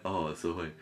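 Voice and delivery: normal talking voice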